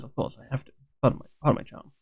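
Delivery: usual speaking voice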